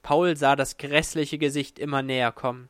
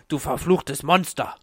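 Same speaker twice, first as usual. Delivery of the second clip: hateful, cursing yell; angry roar. A narrator's reading. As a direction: curse the monster in a rage. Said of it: "brüllte Paul". (hasserfüllt brüllen)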